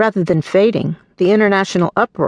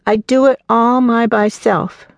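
put-on high, slow little-child voice insisting; be a small girl who wants to do it alone; stubborn toddler; 6 semitones higher, 3 dB louder, slower than usual. Toddler refusing help.

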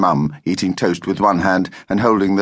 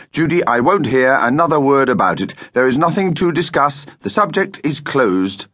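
higher-pitched, emphatic refusal; stern mild yell; 6 semitones higher than usual. A narrator's reading. Father refuses to discuss the trip, stern and emphatic. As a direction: shut the argument down firmly. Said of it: "yelled Dad".

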